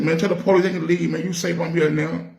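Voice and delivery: high, quick playful tease; teasing an old friend to warm things up; joking. Retold or imitated. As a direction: lighten the mood with a joke. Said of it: "Let me crack a joke".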